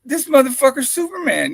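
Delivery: high, loud astonished line; amazed disbelief. Retold or imitated. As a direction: marvel at the guy like he's a superhero. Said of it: "I'm like".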